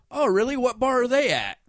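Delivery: high, loud mock questioning; nosy cowboy bar patrons. Cowboy bar people questioning the newcomers, mock loud interest. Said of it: "the people at the cowboy bar are like".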